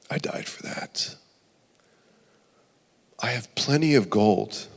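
hushed, whispered tender voice; God's gentle reply. Acted. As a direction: softly whisper the Lord's forgiveness.

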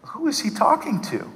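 high, hushed bewilderment; baffled aside. Retold or imitated. Sitting in a service, puzzled by the man praying, baffled aside. Congregant baffled by showy prayer.

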